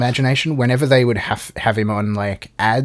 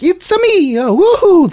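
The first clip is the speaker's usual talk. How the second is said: much higher, louder cartoon Italian plumber yelp; hammed-up video game Mario; 6 dB louder than usual. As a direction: squeak it like the game's Mario. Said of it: "got [name] involved to do the voice".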